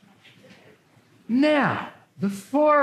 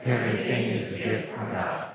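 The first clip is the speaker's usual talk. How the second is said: congregation echo in unison; shadowing; 8 semitones lower, 4 dB quieter than usual. Real, not acted. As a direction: repeat it back together, lower. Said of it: "please repeat after me".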